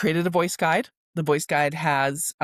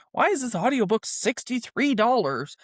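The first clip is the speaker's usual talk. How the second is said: higher-pitched, incredulous question; mock disbelief; 6 semitones higher than usual. Listener looking at audiobook prices, mock disbelief. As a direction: imitate a shopper balking at the price. Voicing a shopper shocked at prices.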